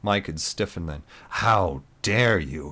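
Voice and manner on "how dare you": slow, outraged challenge; indignant. A narrator's reading. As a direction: drag out an offended how dare you. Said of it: "he began".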